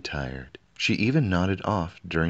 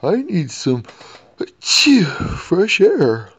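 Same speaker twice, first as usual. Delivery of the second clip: much higher, louder gasp for air; flustered king; 12 semitones higher, 10 dB louder, slower than usual. A narrator's reading. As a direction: puff it out after the sneeze. King voiced.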